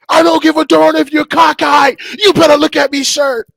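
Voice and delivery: loud, high shouted rebuke; drill sergeant shouting. Retold or imitated. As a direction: blast it back at the recruit. Sergeant shouts back at recruit.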